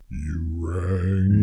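very low, soft monotone butler; deep slow Lurch drawl. Retold or imitated. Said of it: "he always had that deep voice".

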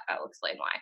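dropped to a hushed whisper. Real, not acted. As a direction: lean in and whisper it like a secret. Conspiratorial aside before explaining.

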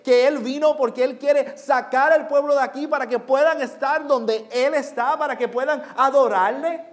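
loud, high-pitched sermon voice, building; impassioned proclamation to a congregation; full-force preaching. Real, not acted. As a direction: preach it out with mounting intensity.

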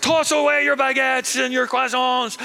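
hammed-up alarm as the enemy walks round; panicked French shout. French soldiers realising Germany came through Belgium, high panicked shouting.

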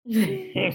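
breaks into a short soft laugh; a real laugh breaking out of the story. Real, not acted. Reader laughing at the grim story.

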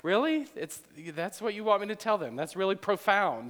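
hammed-up sarcastic doubt at the simple message; mock disbelief. Pastor imitating Sue doubting God's words, mock incredulous. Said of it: "Like Sue said".

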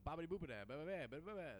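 high mock Italian gibberish; sing-song fake Italian babble. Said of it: "fake Italian".

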